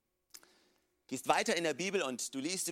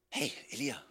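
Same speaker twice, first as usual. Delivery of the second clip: much softer, gentle hushed call; God calling Elijah in a still small voice; soft whisper; 6 semitones lower, 9 dB quieter than usual. Pretend. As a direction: whisper his name tenderly. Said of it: "Gott kommt in einem leisen Flüstern".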